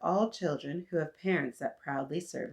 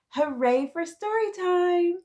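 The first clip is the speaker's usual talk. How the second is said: excited cheer, much higher; 12 semitones higher, 5 dB louder than usual. Acted. Storytime host cheering to young listeners, bright and high. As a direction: cheer it out for the little readers.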